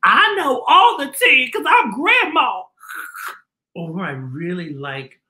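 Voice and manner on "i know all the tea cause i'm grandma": put-on high, louder old-lady voice; high, louder grandma voice. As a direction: play a cheery old grandma. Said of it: "She said".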